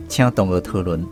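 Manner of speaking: usual speaking voice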